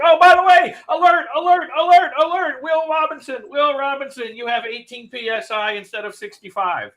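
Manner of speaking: high-pitched loud alert, easing off; mock app alarm. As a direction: blare it like a robot alarm, then settle. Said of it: "It's like".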